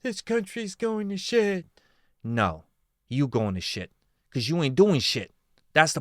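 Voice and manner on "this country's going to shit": higher, mock-dramatic complainer voice; mocking whiny complainer. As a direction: lightly parody the hand-wringing outsider. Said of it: "they're like".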